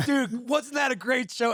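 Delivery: high, slow, innocent cheer; mock innocent. [name] mocking the singer's clueless cheer. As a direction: act like nothing happened. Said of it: "You're like".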